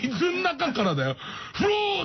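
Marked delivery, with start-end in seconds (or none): 1.5-2.0 short high creature cry; beluga call. Retold or imitated. Beluga crying out at Tashiro, high creature call. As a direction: yelp it like a whale. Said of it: "って言った".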